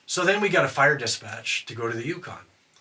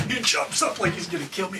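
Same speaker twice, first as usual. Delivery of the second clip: ordinary talk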